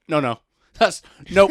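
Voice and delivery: high, loud imitation of refusing teachers; emphatic acted protest; mock outraged refusal. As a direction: refuse loudly as the indignant teachers.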